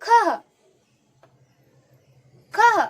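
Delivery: खह as short, loud "khaha"; each खह ending in a puff of h; visarga breath. Shown as a demonstration. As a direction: model खह for pupils to echo. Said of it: "आप भी करो हमारे साथ".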